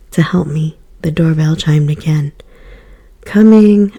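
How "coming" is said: loud call to the door; shouting. A narrator's reading. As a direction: call out loudly. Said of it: "I shouted".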